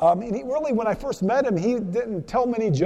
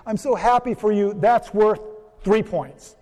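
higher, slow, beaming praise; cheery Saint Peter; 4 semitones higher, slower than usual. Retold or imitated. Saint Peter congratulating the accountant, slow and beaming. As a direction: stretch out the delight like a proud gatekeeper. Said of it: "Peter goes".

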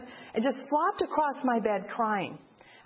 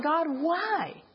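pleading, higher and breaking, retold grief; crying out to God; 5 semitones higher than usual. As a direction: cry out to God in anguish, reliving the loss. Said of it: "I started crying out".

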